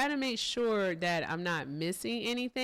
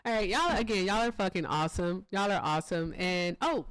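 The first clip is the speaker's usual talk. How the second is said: usual speaking voice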